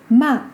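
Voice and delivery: "ma" falling sharply from high; fourth tone. Shown as a demonstration. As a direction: drop "ma" briskly from the top. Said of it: "but have different tones".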